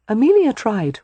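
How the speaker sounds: usual speaking voice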